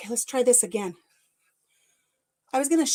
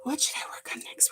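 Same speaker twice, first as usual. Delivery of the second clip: barely audible whisper; softer whisper; 3 semitones higher, 9 dB quieter than usual. Real, not acted. Repeating the question to herself, in a much softer whisper. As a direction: drop right down to a breath. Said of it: "Where's my glasses?".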